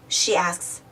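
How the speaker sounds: ordinary delivery, nothing marked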